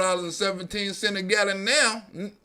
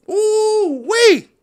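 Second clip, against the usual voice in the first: ooh-wee yelp; 13 semitones higher, 6 dB louder than usual. Real, not acted. Reacting to seven-dollar gas.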